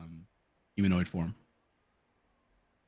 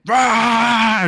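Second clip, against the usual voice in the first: battle scream, high and loud; a long, roaring war cry; Mad Jax; 11 dB louder than usual. Acted. Game master voices a fighter firing his gun, screaming. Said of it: "Mad Jax screaming as he's going".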